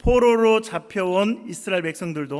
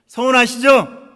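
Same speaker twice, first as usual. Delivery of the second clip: much louder, higher call to the congregation; emphatic loud question; 6 semitones higher, 10 dB louder than usual. Real, not acted. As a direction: press them hard for an answer. Asks again for a response. (크고 높게 다그쳐 묻는 말투)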